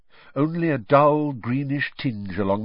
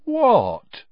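a hint of fake innocence; 14 semitones higher, 7 dB louder than usual. A narrator's reading. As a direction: play innocent, unconvincingly. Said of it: "in an innocent tone that deceived nobody".

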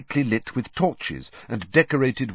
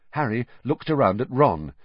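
plain reading voice

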